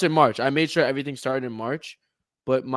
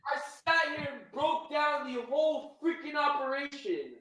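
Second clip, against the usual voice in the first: high, quieter wail of frustration; anguished lament; 10 semitones higher, 8 dB quieter than usual. Real, not acted. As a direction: bemoan wasted effort in a high, softer voice. Lamenting the lost round.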